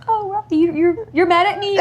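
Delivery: put-on very high child voice; imitated upset daughter. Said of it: "see my daughter like".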